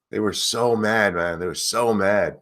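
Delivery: usual speaking voice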